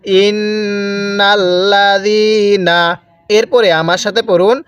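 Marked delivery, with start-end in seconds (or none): 0.1-2.8 ইন্নাল্লাযীনা with the ন্ন held through the nose, slow; nasal ghunnah. Shown as a demonstration. Teacher shows nasal ghunnah in ইন্নাল্লাযীনা. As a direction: hum the নুন of ইন্নাল্লাযীনা nasally. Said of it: "টান দিবেন না".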